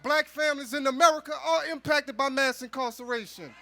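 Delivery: ordinary delivery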